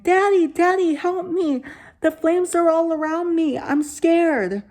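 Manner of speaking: high, loud mock little boy crying for daddy; mocking child's scream. Teen mocking the ghost boy's cry in a high scream. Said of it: "put on a trembling voice to imitate the little boy".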